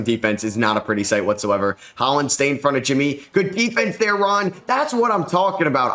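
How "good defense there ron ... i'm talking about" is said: high-pitched cheering praise; excited cheer. Real, not acted. Gamer cheering his rookie's defensive stop, excited.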